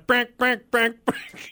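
high horn beeps; honking voice. Retold or imitated. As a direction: beep like a goose horn. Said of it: "your goose noises".

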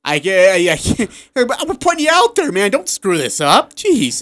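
high, strained coach voice through laughter; half laughing, half despairing; exasperated coach, laughing. Retold or imitated. Coach pleading with his senior, high-pitched and laughing. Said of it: "And then Craig's like".

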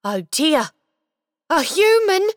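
little elf voice, jumping high and loud on human; exclaimed. Acted. Elf caught by the boy, startled exclamation. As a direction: caught red-handed and startled. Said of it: "he exclaimed".

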